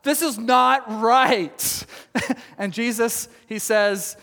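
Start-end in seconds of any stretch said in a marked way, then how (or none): 0.0-1.9 loud, slowing, outraged John; this is backwards, building loud and slow; mock outrage at the wrong order; indignant protest. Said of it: "Basically, he's saying".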